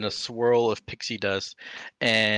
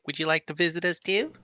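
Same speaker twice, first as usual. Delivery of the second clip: high, airy fairy voice; sweet tiny fairy invitation; 7 semitones higher than usual. Acted.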